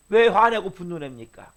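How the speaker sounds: ordinary delivery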